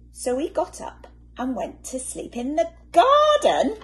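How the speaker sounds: ordinary delivery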